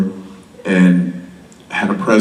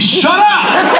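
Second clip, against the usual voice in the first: much higher, laughing mock shout; fake-yell it, clearly joking; mock snap. Dad jokingly yells at his daughter, laughing mock shout. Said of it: "Kidding, I would never say shut up".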